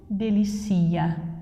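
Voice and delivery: ordinary talk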